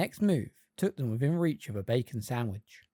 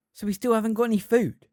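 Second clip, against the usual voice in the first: whiny complaint; 9 semitones higher, 6 dB louder than usual. A narrator's reading. Ron complaining, hungry.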